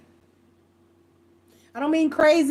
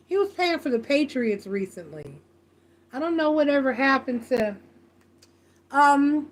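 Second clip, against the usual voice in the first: normal talking voice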